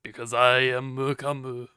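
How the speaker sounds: stately put-on character voice; "I am come" voice. Streamer answers friends' teasing about views, in the character voice. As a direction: declare it in character, drawn out. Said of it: "say that in your I am come voice".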